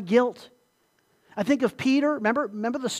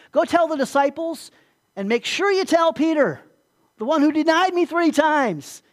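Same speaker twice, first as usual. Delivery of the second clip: louder and higher, pressing the point home; voicing Jesus's urgent message; 7 semitones higher, 6 dB louder than usual. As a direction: call out the message as if Jesus himself is speaking. Jesus's message to Peter, voiced.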